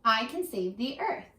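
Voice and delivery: ordinary delivery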